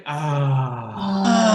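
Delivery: releasing sigh of ah on the out-breath; letting the body relax with a voiced sigh; long exhaled ah. Shown as a demonstration. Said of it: "use your capacity for sound".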